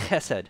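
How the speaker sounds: chesed with a rough throat-clearing ch at the start; throaty ch sound. Shown as a demonstration. Pastor teaching the congregation Hebrew, throaty ch. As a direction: scrape the ch of chesed from the back of the throat. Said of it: "it's like clearing your throat".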